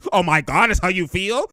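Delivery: ordinary talk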